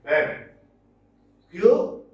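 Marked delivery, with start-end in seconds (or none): 1.5-2.0 "humor" with the first syllable high and loud; emphatic HU-mor for "humor"; stress on first syllable. Shown as a demonstration. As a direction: punch the start of "humor", leave the end weak. Teacher demonstrates first-syllable stress.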